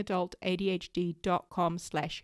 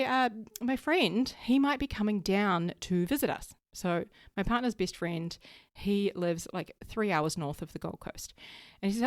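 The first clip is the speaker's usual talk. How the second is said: usual speaking voice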